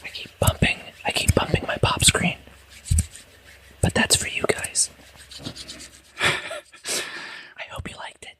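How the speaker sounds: soft, voiceless whisper; hushed mock-ASMR whisper. Shown as a demonstration. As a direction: whisper intimately to the listeners. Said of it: "Whispering finger snapping".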